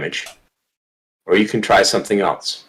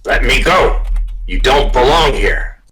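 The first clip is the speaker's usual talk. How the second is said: raised, higher voice of an indignant dog; talking dog; 5 semitones higher, 4 dB louder than usual. Acted. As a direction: protest loudly as the captive dog. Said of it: "You can understand what it says".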